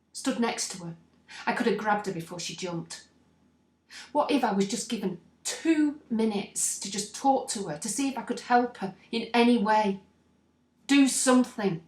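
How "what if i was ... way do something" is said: rising higher and louder, desperate to help her; anguished pleading. Acted. Bystander replaying how they might have helped her, anguished. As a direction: build urgency toward a loud final plea. Dwelling on what ifs after a death.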